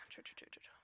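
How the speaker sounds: breathy whisper. Real, not acted. Whispered repetition.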